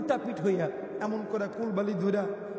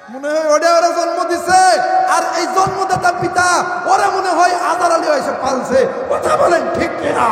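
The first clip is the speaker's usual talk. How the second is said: loud, raised sermon harangue; fiery preaching; 7 semitones higher, 11 dB louder than usual. Real, not acted. Preacher scolds sons who obey their wives, fiery loud preaching. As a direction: berate them at full force from the pulpit.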